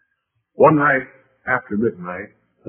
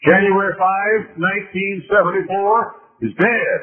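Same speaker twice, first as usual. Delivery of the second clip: fervent sermon climax; preaching at full force; 10 semitones higher, 4 dB louder than usual. Acted. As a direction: proclaim it from the pulpit.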